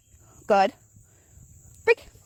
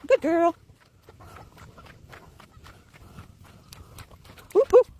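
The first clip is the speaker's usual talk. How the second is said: much higher, louder praise for the dog; 8 semitones higher, 3 dB louder, slower than usual. Real, not acted. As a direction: cheer the dog on, sing-song high. Praising her dog on a walk.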